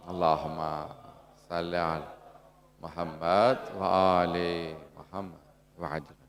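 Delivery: low, soft, measured prayer recitation; salawat recitation. Real, not acted. Preacher leading the congregation in salawat, low prayerful recitation.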